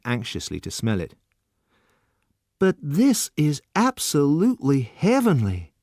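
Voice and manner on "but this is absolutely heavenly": slow, much higher rapturous praise; crying out in delight. A narrator's reading. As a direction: savour every word. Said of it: "he cried".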